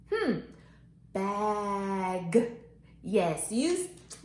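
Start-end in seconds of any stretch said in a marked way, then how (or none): 1.1-2.5 BAG held long, G released separately; slow level Bag with a detached G; stretched-out word. Shown as a demonstration. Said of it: "let's stretch it out".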